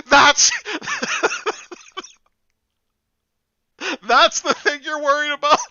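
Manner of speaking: high, loud disbelief; incredulous shout. Real, not acted.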